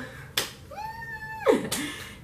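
long, very high squealed sound; real high-pitched squeal. Real, not acted. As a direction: let out a thin high squeal. Own high squeal in pause.

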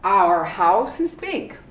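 "Our" said as a plain R, no O U; unhurried drill, "Our" like an R; slow, r-colored our. Shown as a demonstration. Teacher slowly modelling "Our house is big". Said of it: "You do completely without the O U".

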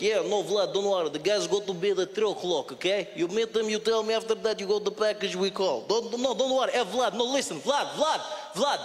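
brisk Russian criminal on the phone; thick Russian accent, urging and reassuring; Russian-accented English. Retold or imitated. Russian setting up a drug deal by phone, thick accent. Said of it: "the English Russian accent".

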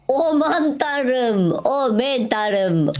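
high, slow drawn-out "Aman tanrım"; amazed gasp. Real, not acted. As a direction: stretch every syllable in open-mouthed wonder. Finds ores, drawn-out awe.

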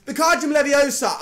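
high, pompous spell-casting chant; mock Voldemort spell. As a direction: cast it like a dark wizard. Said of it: "I'm like Voldemort type shit".

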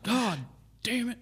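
high, strained exasperated dad cussing; mock outburst from an upset dad; imitated cartoon-dad swearing; Hank Hill. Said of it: "turned into Hank Hill".

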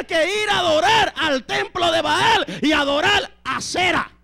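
high, loud shouted sermon; fired-up preacher; full-force preaching. Acted. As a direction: proclaim it to the congregation at full force.

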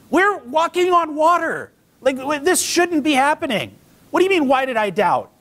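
high-pitched mock outrage; incredulous protest. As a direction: protest in exaggerated disbelief. Acting Peter's incredulous protest.

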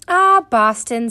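slow, loud drawn-out "Ah"; wistful address. A narrator's reading. Missus Pepper watching the tallyman leave, slow and wistful. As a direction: let it out slowly to your son. Mother sighing to her son after farewell.

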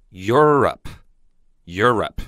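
Europe said slowly and clearly twice; teacher's model word. Shown as a demonstration. Teacher to students, slow careful model. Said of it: "欧洲英文叫Europe".

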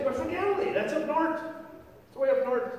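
high, singled-out jibe; high-pitched accuser. Acted. Bystanders place Peter by his accent, high-pitched accusing. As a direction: point out the outsider in a high voice.

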